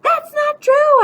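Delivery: high, loud, drawn-out protest; screaming. A narrator's reading. Boy protesting grandma's story about Mom, screaming. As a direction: scream the denial slowly. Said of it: "I'd scream".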